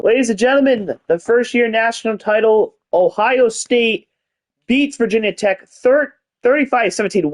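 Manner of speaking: ladies-and-gentlemen presenter voice; mock announcer. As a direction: announce the winner like a TV host. Announces the title game result.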